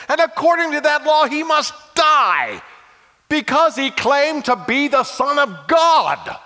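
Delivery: loud, high indignant protest; objecting loudly. Acted. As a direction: cut in hard to object.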